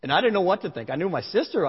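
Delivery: ordinary delivery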